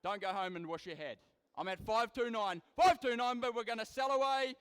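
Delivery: rapid rhythmic auction chant, punching prices; auctioneer. Acted. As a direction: rattle off bids like a livestock auctioneer. Live cattle auction bid calling.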